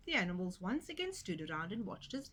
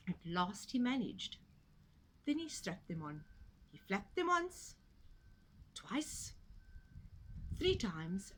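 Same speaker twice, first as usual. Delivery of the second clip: ordinary delivery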